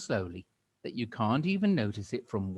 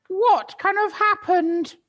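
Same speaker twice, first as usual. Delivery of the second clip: put-on much higher, loud, fussy old-woman voice; shrill old Missus Twit; 13 dB louder, slower than usual. Missus Twit startled, answering her husband in a high old-woman voice. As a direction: play a flustered old hag. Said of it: "Missus Twit said".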